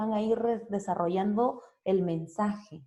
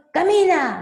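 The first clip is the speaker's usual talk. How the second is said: "Camina" yelled much higher and louder; yelled command; 10 semitones higher, 10 dB louder than usual. Shown as a demonstration. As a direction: bark "Camina" like an angry parent. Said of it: "no voy a decir". ("Camina" gritado, muy alto y fuerte)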